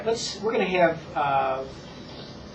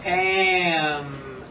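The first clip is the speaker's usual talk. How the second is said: long, evenly held sounds; slow model. Shown as a demonstration. Teacher says it slow for Paul, drawn out and sustained. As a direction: stretch "Am" out without a break. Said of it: "I will say it slow".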